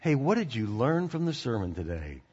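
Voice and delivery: soft and sincere, set against the quick chatter; model question, soft. Shown as a demonstration. Pastor models the better question for the congregation, softly. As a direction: ask it gently, like you mean it. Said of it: "You say".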